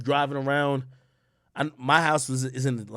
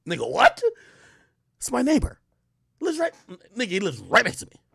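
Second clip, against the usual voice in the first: high, disbelieving outburst; can't believe it, pitch climbing; incredulous; 10 semitones higher, 3 dB louder than usual. Real, not acted. Comic tells crowd about rich neighbor, incredulous high outburst.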